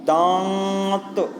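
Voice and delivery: दांत said slowly with nasal आ; nasal vowel demo. Shown as a demonstration. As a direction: stretch दांत through the nose. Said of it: "नाक से दोनों से हो रहा है". (दांत को धीरे अनुनासिक बोलना)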